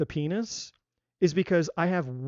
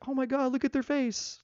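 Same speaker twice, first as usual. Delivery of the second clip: imitated woman moved by a face, pitched high; empathic woman; 8 semitones higher than usual. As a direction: lift the voice high in acted sympathy.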